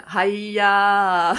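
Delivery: laughing exclamation; emotional sound demo. Shown as a demonstration. Host demonstrating an emotional vocal sound, laughing. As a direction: toss off an emotional hey-ya with a laugh. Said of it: "就是".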